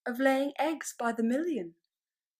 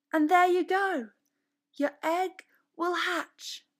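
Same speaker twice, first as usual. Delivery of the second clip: chickens instructing; 6 semitones higher, 9 dB louder than usual. A narrator's reading. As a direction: cluck out each step like a bossy hen. Chickens give egg-laying steps.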